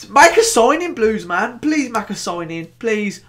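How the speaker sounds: high, exasperated fan's plea; mock pleading. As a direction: beg your club like a desperate fan. Fan begs his club to sign.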